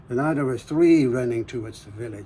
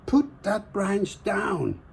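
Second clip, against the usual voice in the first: commanding palm tree; 7 semitones higher, much slower than usual. Acted.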